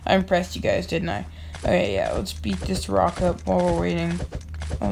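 normal talking voice

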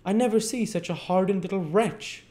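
high, loud, scolding condemnation; malignant scorn. A narrator's reading. Missus Mann glaring at Dick, malignant. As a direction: condemn the child with high-pitched indignation. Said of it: "looking malignantly at Dick".

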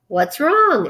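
lightly voiced startled announcer; fairground loudspeaker. A narrator's reading. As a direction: call out the question in concern. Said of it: "asked the loudspeaker".

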